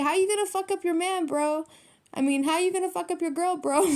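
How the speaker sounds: high-pitched, incredulous teasing; amused mock challenge. Reader calling out a partner's attacker, amused high mock challenge. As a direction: call them out in a high mock protest.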